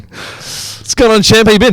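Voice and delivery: slow, drawn-out matey hello, champ; mock matey greeting. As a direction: greet grandpa like a gym buddy. Matey greeting to old man.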